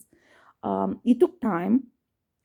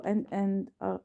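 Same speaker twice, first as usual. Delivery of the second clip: usual speaking voice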